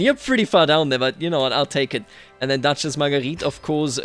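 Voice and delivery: ordinary talk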